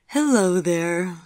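slow, drawn-out hello; a drowsy Nadine greets the room; sleepy greeting. A narrator's reading. Nadine, just woken, greets Chip and Vivian sleepily.

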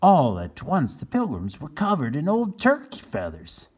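usual speaking voice

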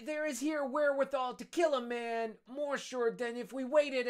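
high, loud character voicing; character voices. Conspirators plotting over wine, high hammed voices. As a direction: ham up the drunken schemers. Voices drunk Caderousse and Danglars.

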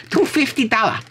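put-on very high, caricatured Taiwanese accent. Joking about finding the record in Taiwan, high mock Taiwanese accent. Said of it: "If I was in Taiwan".

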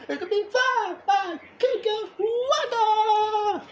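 high, loud and slow with laughter; can barely contain the joy; overexcited. Real, not acted. To his friend about camp, gleefully laughing. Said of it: "Okay, calm down".

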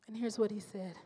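ordinary talk, nothing marked

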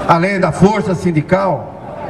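usual speaking voice

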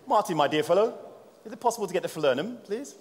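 put-on high-pitched, courteous English accent; best English accent. Presenter asking colleague Martin for the falernum, mock English accent. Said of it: "That's my best English accent".

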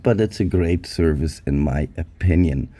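normal talking voice

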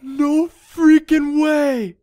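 high, loud, slow disbelief; amazed shout. Real, not acted. Player stunned by a restocked chest, amazed shout. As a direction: stretch each word out in astonishment.

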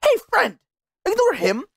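high, silly voice mimicking a friend; mocking imitation. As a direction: parody him in a high squeaky voice. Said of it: "and then you decided".

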